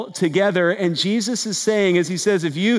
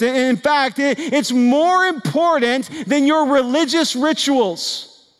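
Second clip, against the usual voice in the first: high-pitched, insistent preaching voice; impassioned preaching; 7 semitones higher than usual. Real, not acted. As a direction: drive each phrase home with rising urgency. Preacher drives the main point home.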